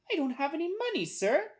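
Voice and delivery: put-on high-pitched child's reply; little boy. A narrator's reading. Boy telling salesman he has no money, high child voice. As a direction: answer a grown-up in a small high voice.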